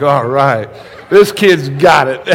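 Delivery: real laughter of a proud grandfather; laughing delight. Real, not acted. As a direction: chuckle through it, tickled pink.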